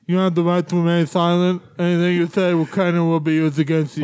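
put-on officer voice with thick, muffled consonants; mock arrest in a celebrity impersonation; Lou Ferrigno. Officer reading a suspect their rights, impersonated. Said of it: "my terrible Lou Ferrigno impersonation".

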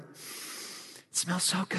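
hushed whisper to his wife over the soup. Retold or imitated. Whispering to his wife at the dinner table. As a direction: breathe it out in a whisper, savoring.

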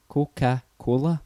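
"Coca-Cola" slowly, piece by piece; syllable by syllable. Shown as a demonstration. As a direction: sound out "Coca-Cola" in slow separate chunks. Demonstrating counting syllables of words.

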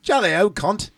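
high, hammed-up posh British accent. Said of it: "I don't speak the Queen's".

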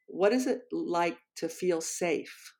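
soft, slow imitation of a shy student; very quiet voice. Seventh grader quietly shares his question in class, very quiet voice. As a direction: say it slowly and very softly, as if barely audible. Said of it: "in this very, very quiet voice".

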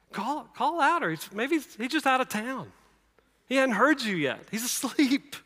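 high-pitched teasing voice; mocking. Elijah teasing Baal's prophets about their missing god, mocking. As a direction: pitch it high and light, taunting with fake helpfulness. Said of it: "Elijah's mocking him".

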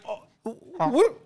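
puzzled high voice to the cat; baffled. Retold or imitated. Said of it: "and I'm like".

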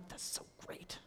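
whispered, deadpan "so great"; whispered, flatly insincere praise; flat fake enthusiasm. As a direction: murmur it with no joy at all. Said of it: "And I'd be like".

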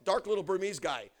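usual speaking voice

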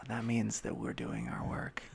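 unvoiced ASMR whisper. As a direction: whisper it soothingly.

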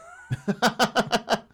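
high, loud giggling burst; helpless high cackle; laughing. Real, not acted. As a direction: crack up at your own words. Laughs at own childhood game.

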